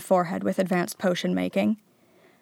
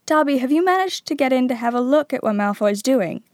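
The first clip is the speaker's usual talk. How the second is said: keen, raised and slightly louder question; eager; 5 semitones higher, 4 dB louder than usual. A narrator's reading. Harry asking Dobby about spying on Malfoy, eager. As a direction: ask it with lively eagerness. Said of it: "said Harry eagerly".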